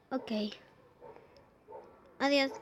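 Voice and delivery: ordinary talk, nothing marked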